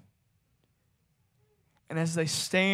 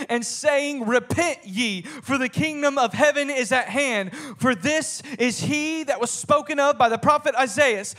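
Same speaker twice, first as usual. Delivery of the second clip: raised, driving preacher's voice; forceful preaching; 5 semitones higher, 4 dB louder than usual. Acted. Preacher declaring John's message out of the wilderness, forceful. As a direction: proclaim the scripture with force.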